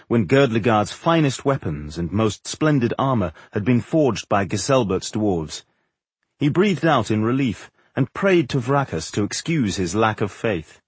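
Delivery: plain reading voice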